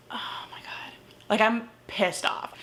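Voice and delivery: hissed whisper; whispered fury. Real, not acted. Reviewer seethes to her viewers about the book in a whisper.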